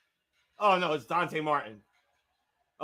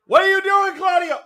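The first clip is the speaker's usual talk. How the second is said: loud, much higher disbelieving shout; incredulous yell; 11 semitones higher, 7 dB louder than usual. Real, not acted. Yelling at wrestler.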